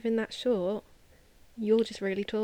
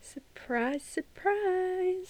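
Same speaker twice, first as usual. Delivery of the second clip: sing-song "surprise, surprise", rising higher; mock surprise; 9 semitones higher, much slower than usual. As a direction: draw it out in a high, knowing lilt. Mock surprise at Ferrari's blunder.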